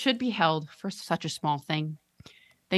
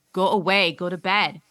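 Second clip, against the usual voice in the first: loud angry shout; 7 dB louder than usual. Acted. Parents yelling at child.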